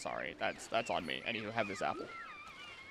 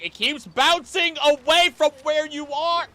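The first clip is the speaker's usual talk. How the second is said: higher, louder, frustrated outburst; exasperated shout; 14 semitones higher, 10 dB louder than usual. Real, not acted. Streamer yelling at a fleeing fish, exasperated shout. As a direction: yell after a creature that keeps swimming off.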